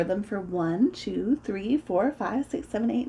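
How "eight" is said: usual speaking voice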